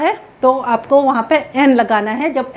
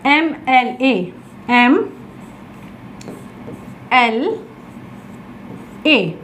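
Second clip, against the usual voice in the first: slow, spelled out letter by letter; 4 dB quieter, much slower than usual. Shown as a demonstration. Teacher to students, spelling the abbreviation out slowly. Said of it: "इसको हम कैसे पढ़ते हैं".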